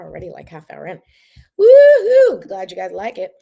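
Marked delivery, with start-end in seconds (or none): 1.6-2.5 very high, loud woohoo; cheering. Real, not acted. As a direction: cheer for the milestone. Cheering half hour mark.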